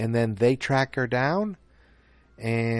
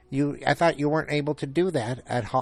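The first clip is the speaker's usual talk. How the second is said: higher-pitched whiny imitation of complainers; mock complaining; 4 semitones higher than usual. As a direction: mimic nitpicking fans in a raised voice. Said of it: "I remember people complaining".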